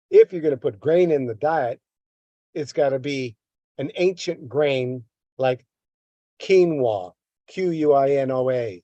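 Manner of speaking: normal talking voice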